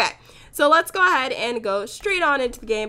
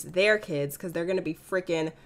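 ordinary talk, nothing marked

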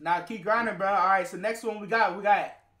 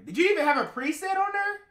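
incredulous; 11 semitones higher, 3 dB louder than usual. Real, not acted. Incredulous at the artist.

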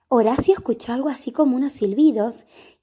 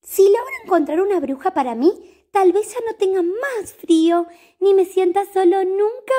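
hopeful cat voice; 7 semitones higher, 5 dB louder than usual. Acted. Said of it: "pensó Horacio".

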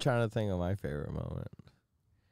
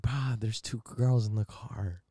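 stoned excuse; slower than usual. Retold or imitated. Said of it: "I'm like".

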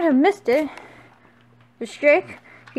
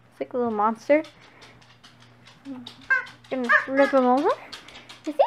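usual speaking voice

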